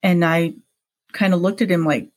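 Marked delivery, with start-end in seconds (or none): none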